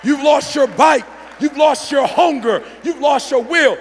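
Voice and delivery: high, loud, slow hammering; preaching shout. Acted. Speaker shouting the lesson to the crowd, slow and loud. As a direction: drive each line home like a preacher.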